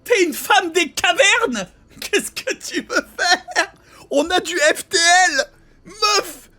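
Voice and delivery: high, laughing outrage; incredulous rant. Real, not acted. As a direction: shout in disbelief, cracking up. Ranting at game character, laughing.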